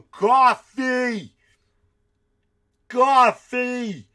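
high, loud yell of coffee; imitated shout. Comedian mimicking a moviegoer shouting random words, loud yell. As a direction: holler it out of nowhere like the moviegoer. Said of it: "And then he go".

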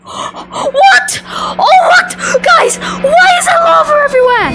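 high, excited screaming shock; shocked excitement. Acted. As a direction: shriek in disbelief. Excited shock at lava world.

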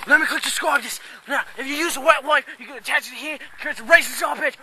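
hammy panic at a bear; panicked. Pretend.

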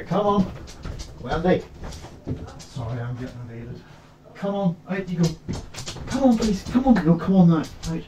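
high, soft coaxing call to a dog; softly calling the dog to come. Real, not acted.

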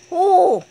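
loud, high "oh!"; sudden high yelp at full volume; startled cry. Real, not acted. Gamer caught out in a fight, startled cry.

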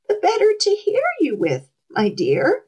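high put-on granny voice; sweet and high, pretending to be Grandma; wolf as Grandma. A narrator's reading. Wolf answering the girl, faking Grandma's voice. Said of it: "in Grandma's voice".

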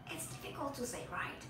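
very soft, almost under the breath; hushed aside. Real, not acted. Teacher admits to students it's hard, hushed aside. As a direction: drop right down to confide in the learner.

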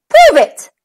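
high, loud dare; dog's challenge. A narrator's reading. The little dog daring the cow. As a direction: snap out a dare. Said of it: "said the dog".

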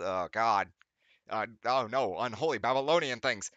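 loud groaning dismay; mock horror. Real, not acted. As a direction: recoil loudly like it's unholy. Horrified reader.

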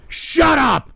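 shut up. Real, not acted.

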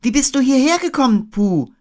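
put-on high, loud amazed boy; Christopher Robin. A narrator's reading. Boy asking Pooh after the reunion, high and loud. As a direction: ask it pitched well up. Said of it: "fragte Christopher Robin".

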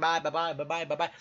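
a machine-gun goodbye; rapid-fire byes. Acted. YouTuber hurrying his viewers out, rapid byes.

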